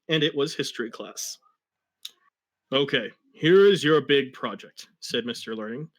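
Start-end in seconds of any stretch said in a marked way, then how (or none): 2.7-4.9 louder, stern classroom announcement; lightly voiced stern teacher; a hint of a bossy teacher. Teacher voiced in read story.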